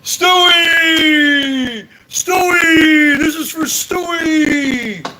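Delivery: hammed-up drunk shouting a name, drawn out; mock drunken yelling. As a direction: yell it like a sloppy drunk at a wake. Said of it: "you go".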